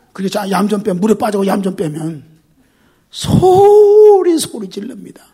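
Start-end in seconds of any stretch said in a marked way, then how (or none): 3.1-4.4 high, loud, very slow yell; drawn-out scream. Acted. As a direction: stretch it out like a scream. Drowning person screams. (길게 외치듯)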